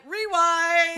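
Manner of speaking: high-pitched, drawn-out call; playfully hammed-up high voice; mock viewer reaction. Acts out viewers scrolling back.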